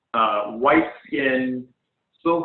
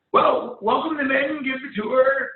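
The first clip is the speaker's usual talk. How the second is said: higher, brisk commanding guildmaster; 10 semitones higher than usual. Acted. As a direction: rattle off the orders, busy. Ender gives orders.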